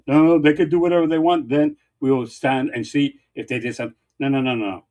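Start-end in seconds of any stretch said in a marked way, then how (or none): 0.1-1.5 a hint of a mock opponent voice, a bit higher and louder. Mocking the senator's stance on ice for viewers, lightly voiced parody. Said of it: "That's her position".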